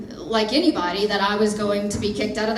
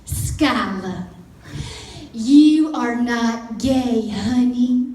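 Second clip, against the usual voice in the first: slow, drawn-out Southern mama voice; sweet Southern accent; 5 semitones higher, slower than usual. Retold or imitated. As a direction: stretch each word in a sweet Southern accent. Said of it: "in her sweet Southern accent".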